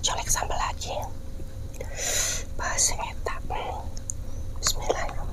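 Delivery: soft unvoiced whisper. Real, not acted. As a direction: whisper it close to the mic. Whispering while eating on camera.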